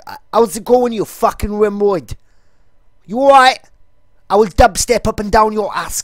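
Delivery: high, loud exaggerated London geezer accent; geezer character. Vlogger demos his geezer character in an overpronounced London accent. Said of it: "like, the Ali G character".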